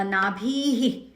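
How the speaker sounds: नाभिः with the visarga echoing the i. Shown as a demonstration. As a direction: stretch नाभिः and let the visarga sound as "hi". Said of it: "तो भी".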